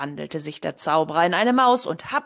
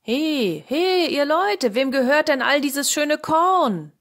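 high, loud, drawn-out hail; calling out; 7 semitones higher, 9 dB louder, slower than usual. A narrator's reading. King asks who owns the fine corn, calling out. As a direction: holler from afar to a crowd. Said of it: "ließ anhalten und rief".

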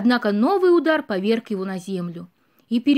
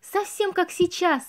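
playful exclamation; 7 semitones higher, slower than usual. Acted. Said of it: "весело воскликнула".

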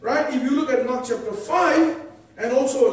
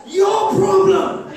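loud, high rebuke; child's loud scolding; 5 semitones higher, 5 dB louder than usual. Retold or imitated. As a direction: scold him like a fearless little girl. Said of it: "She said".

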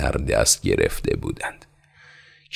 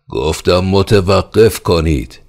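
louder, slow, high, pounding command; furious repeated order; 6 semitones higher, 8 dB louder, slower than usual. Acted. Pale-faced khan repeating his order in fury. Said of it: "از زور خشم".

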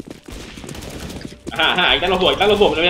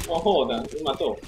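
normal talking voice

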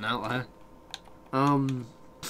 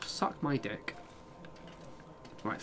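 usual speaking voice

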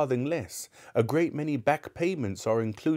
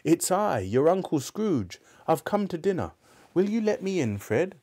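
put-on a happy old man at the party; joyful Scrooge; 2 semitones higher than usual.

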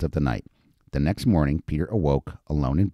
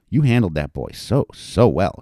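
usual speaking voice

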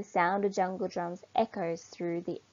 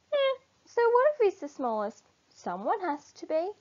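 much higher, offhand animal voice; shrugging animals; 10 semitones higher than usual. Acted.

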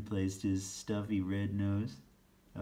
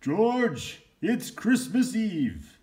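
high, loud, slow call; 13 semitones higher, 9 dB louder, much slower than usual. Acted. Santa calls George from the sleigh, loud and slow. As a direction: call across the yard to him. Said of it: "he called out".

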